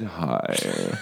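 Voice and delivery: slow creaky hi; vocal fry. Pretend. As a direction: drag it out with fry. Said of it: "on our vocal fry".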